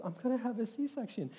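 high, soft, small voice; imitated heartbroken wife. Wife telling husband about the surgery, imitated small and heartbroken. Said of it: "she said it in this voice".

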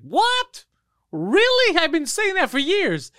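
high, incredulous what, really; surprised. Retold or imitated. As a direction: play the friend caught off guard, voice shooting up. Friend voiced surprised.

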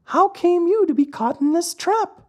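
very high, tiny glowworm voice; inquiring glowworm. Acted. Glowworm questions the trapped puppet, very high. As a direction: ask in a small creature's high pitch.

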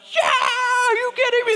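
high-pitched mock squeal of excitement; excited little boys. Neighbor boys agreeing to hunt a mouse, imitated excited squeal. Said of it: "they're freaking out".

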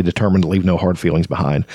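normal talking voice

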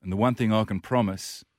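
rousing, measured recital of a tribute poem; poem recitation. Acted. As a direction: recite it like a team battle poem, letting each verse settle.

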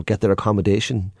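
ordinary talk, nothing marked

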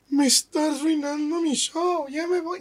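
high-pitched, drawn-out imitation of a complainer; mock whining. Comedian upset by a heckler leaving the show, mock high whine. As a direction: whine it high, mocking a thin-skinned performer.

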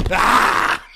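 hammed-up wrestler promo yell; wrestling promo. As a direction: cut a wrestling promo, screaming. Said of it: "got a fucking W W E promo".

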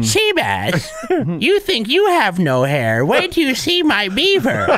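high, slow horny granny; Seabass's grandma. Acted. Host as grandmother ordering her grandson, slow granny voice. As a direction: draw it out like a lewd old woman. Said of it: "Seabass's horny grandmother voice".

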